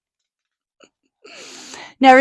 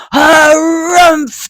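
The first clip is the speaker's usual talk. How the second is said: loud furious trunk blast; angry elephant harrumph; 7 semitones higher, 7 dB louder than usual. Acted. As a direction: shout the harrumph in a rage.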